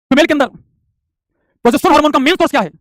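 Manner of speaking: high, loud, quick question; announced quiz question. Real, not acted. Teacher putting second question to students, loud announcement. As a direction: fire the question out to the whole class.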